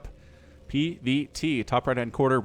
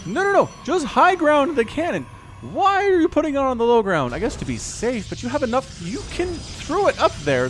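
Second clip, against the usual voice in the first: higher and louder, pleading with the player; no no no, do it right; exasperated urging; 11 semitones higher, 4 dB louder than usual. Real, not acted. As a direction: caster yelling advice at the player, higher and louder.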